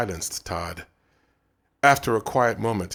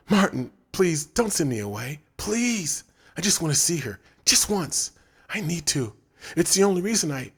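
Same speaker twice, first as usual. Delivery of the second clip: a touch of tearful begging, raised pitch; choked pleading; 8 semitones higher than usual. Acted. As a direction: plead not to be sent away, close to tears. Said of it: "His voice was choked, and tears".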